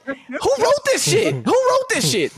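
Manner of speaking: incredulous. Real, not acted. Ranting to friends about a terrible movie, high laughing disbelief. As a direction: squeal it up high in amazement.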